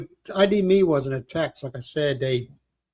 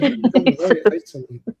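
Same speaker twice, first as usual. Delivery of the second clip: laughing; 6 semitones higher, 5 dB louder than usual. Retold or imitated. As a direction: quote repeated through laughter, higher and louder. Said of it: "He said".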